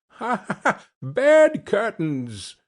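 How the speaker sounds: high cackling laugh into the words. A narrator's reading. The charwoman laughing at Joe about the stolen curtains. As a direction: cackle through "Bed curtains!", drawn out slowly. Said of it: "laughing and leaning forward".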